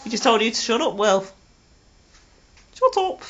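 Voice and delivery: normal talking voice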